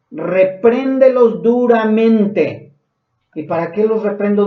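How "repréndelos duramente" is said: slow, weighty reprimand, a bit louder; stern reading of the verse. Shown as a demonstration. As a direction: lean on every syllable of "Repréndelos duramente". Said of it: "Cuando dice de esto".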